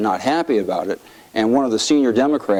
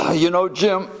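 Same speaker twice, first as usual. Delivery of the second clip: higher-pitched mock tearful voice; Henry Hyde crying; 6 semitones higher, slower than usual. Weeping chairman confiding in colleague at funeral, tearful. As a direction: imitate a man choked up. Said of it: "while he's crying, he says this".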